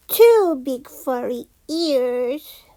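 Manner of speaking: usual speaking voice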